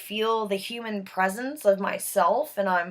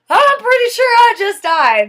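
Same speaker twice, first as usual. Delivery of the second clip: higher and louder, pressing the point; exasperated insistence; 12 semitones higher, 11 dB louder than usual. Retold or imitated. Woman insisting to her friend she died, loud and exasperated. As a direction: insist loudly he isn't listening.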